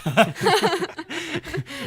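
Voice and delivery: loud squealing burst of laughter; real high-pitched laugh; high shrieking laugh. Real, not acted. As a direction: cackle up high at the joke. Laughs at the wife joke.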